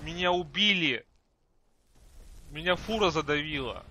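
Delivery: loud gasp, then shocked cry; startled. Real, not acted. Startled by sudden attack.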